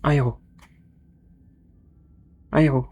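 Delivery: loud repeated spell command; shouted spell. Real, not acted. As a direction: yell the spell at the mic.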